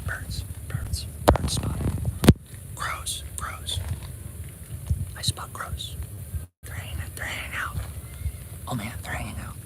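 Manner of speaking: hushed excited whisper. Real, not acted. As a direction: whisper so the birds won't hear. Quietly spotting crows nearby.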